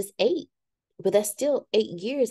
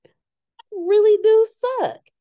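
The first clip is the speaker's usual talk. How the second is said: self-mocking admission; 12 semitones higher, 6 dB louder, slower than usual. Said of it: "have learned".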